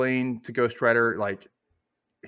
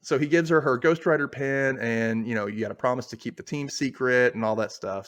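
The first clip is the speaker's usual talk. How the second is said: ordinary talk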